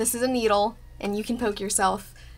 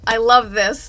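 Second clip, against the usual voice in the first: louder and delighted; excited gush; 8 dB louder than usual. Real, not acted. Loving her embroidery, a loud happy gush. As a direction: gush with joy.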